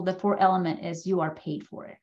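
ordinary talk

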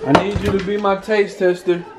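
ordinary talk

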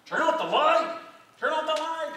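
loud, high-pitched yell; hammed-up angry shout. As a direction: shout in outrage at whoever hit the switch. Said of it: "They start shouting at you".

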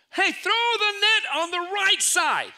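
high, loud, slow call. Retold or imitated. Jesus calling to the disciples in the boat, loud and slow. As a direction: stretch it out across the water.

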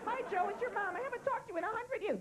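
mom on phone. Pretend. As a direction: chirp it like his mother calling. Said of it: "the phone rings".

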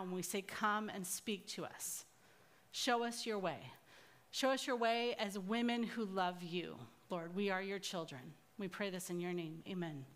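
soft, low, unhurried prayer; hushed praying with long pauses. Real, not acted. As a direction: lead the room gently in prayer. Leads opening prayer.

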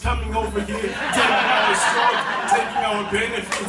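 loud, indignant crowd grumbling; hammed-up xenophobic indignation; mock outrage. Zoo animals resent the foreign panda.

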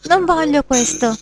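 put-on high, begging girl's voice; voce di Aya. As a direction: plead not to be left alone. Aya begs her mother. (bambina che supplica, voce alta)

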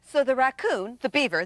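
ordinary delivery

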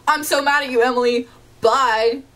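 hammed-up angry boyfriend, high and loud; Jeff, mock mad. Imitating the boyfriend to Emily after their fight, mock angry. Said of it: "And he was like".

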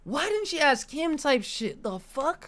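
ordinary talk, nothing marked

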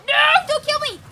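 real frantic shout; panicked shout. Real, not acted. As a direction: yell at the car bearing down. Rival car about to hit.

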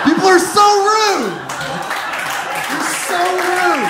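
high, loud whiny outrage; exasperated shout. Acted. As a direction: wail about rude people. Comic rant about rudeness.

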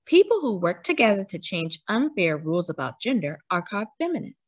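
plain reading voice

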